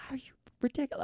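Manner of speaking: whispered instead of the yell she describes; a breathy, incredulous whisper; hushed mock yell. Retelling yelling at the airline agent, whispered mock outrage. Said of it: "but I did yell at her".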